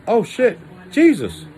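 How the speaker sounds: high, startled cry at the mirror; shocked yelp. Pretend. Waking up to see Jesus in the mirror, shocked yelp. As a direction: jump back in shock.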